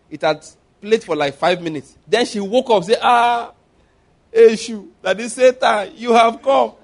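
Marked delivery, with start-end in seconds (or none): 3.0-6.7 raised, higher voice calling Satan out; startled rebuke. Retold or imitated. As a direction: cry out at Satan as you wake. Woman wakes, confronts Satan.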